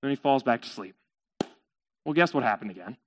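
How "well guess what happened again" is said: low, soft storyteller's question; hushed teaser. A narrator's reading. Storyteller to listeners, hushed teasing. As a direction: ask it quietly, knowingly.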